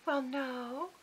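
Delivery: high, soft, syrupy Southern mother; butter-wouldn't-melt Southern innocence; sweet Southern voice. Retold or imitated. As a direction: imitate a sugary Southern lady. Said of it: "so sweet butter wouldn't melt in her mouth Southern voice".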